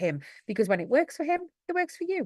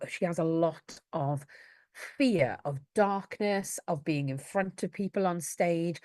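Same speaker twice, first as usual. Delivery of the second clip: ordinary delivery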